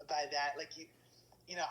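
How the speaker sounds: ordinary talk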